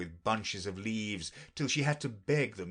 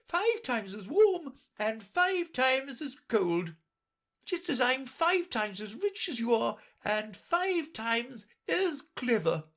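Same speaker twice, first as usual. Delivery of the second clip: put-on a bossy queen lecturing the girl; Red Queen voice; 4 dB louder than usual. A narrator's reading. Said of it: "cried the Queen".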